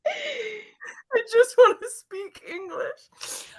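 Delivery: high, slow comic whine; squeaky mock wail; giggly mock despair. Giggling despair at failed accent.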